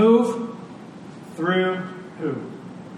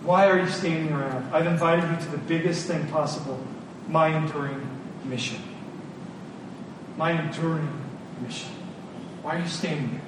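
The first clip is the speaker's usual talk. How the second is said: voicing God softly; 2 semitones lower, 7 dB quieter than usual. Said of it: "God says passionately".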